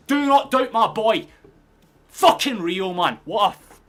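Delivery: loud, high, emphatic boast about your player; real jubilant yell, drawn out; triumphant shout. Real, not acted. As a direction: shout it proudly to the doubters. Celebrating the scorer.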